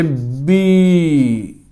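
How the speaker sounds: बी held long and slow; drawn out vowel. Shown as a demonstration. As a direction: stretch the ई in बी. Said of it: "लंबा खींचेगा". (बी को लंबा खींचकर)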